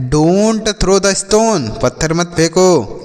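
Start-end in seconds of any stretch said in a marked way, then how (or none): 0.1-1.7 very slow, every word clear; slow model sentence. Shown as a demonstration. Teacher slowly models "Don't throw the stone" for the children. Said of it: "तो आप बोल सकते हैं".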